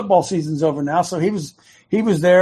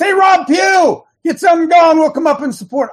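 shouting out; 10 semitones higher, 6 dB louder than usual. Acted. Host shouting at Rob Pugh to get something going. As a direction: stretch the name into a rally cry. Calling out a named ally.

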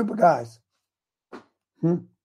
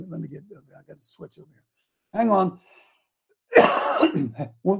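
ordinary talk

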